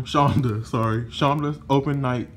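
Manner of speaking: normal talking voice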